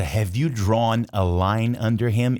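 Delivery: "Have you drawn a line under him?" said slowly, word by word; slow, clear demo. Shown as a demonstration. Teacher repeating the question slowly. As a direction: spell out the question for learners. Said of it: "Olha o que ele pergunta".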